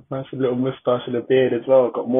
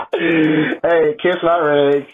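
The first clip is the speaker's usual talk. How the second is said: hype shouting; 6 semitones higher, slower than usual. Real, not acted. Hyped, shouting along.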